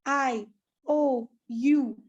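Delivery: ओ and यू stretched slow with the mouth held open; long clear open vowels for the class; drawn-out vowel demo. Shown as a demonstration. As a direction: sound out each vowel slowly, open mouthed. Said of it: "जरा पढ़ो".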